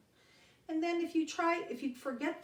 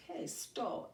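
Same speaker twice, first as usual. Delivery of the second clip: ordinary delivery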